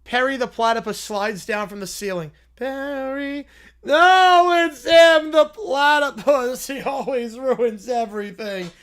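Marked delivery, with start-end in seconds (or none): 2.6-8.7 villain outcry. Acted. Panicked villain cry.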